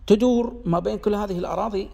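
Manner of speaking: normal talking voice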